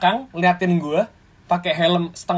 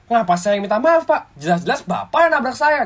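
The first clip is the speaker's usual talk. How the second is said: raised, louder indignant retort; telling off the rider who hit me, raised and louder; retold angry protest; 7 semitones higher, 6 dB louder than usual. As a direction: snap back at the stranger, pointing out his fault. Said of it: "Gue marah-marah gitu".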